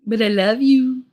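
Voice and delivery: high, loud loving pet talk. Real, not acted. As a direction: tell the cat you love her. Talking to the cat.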